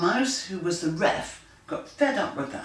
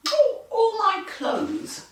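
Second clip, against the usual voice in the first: much higher, slow put-on lady voice; Missus MacDonald; slower than usual. Missus MacDonald answers her husband, high slow lady voice. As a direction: sing it out high like a prim lady. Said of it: "said Missus MacDonald".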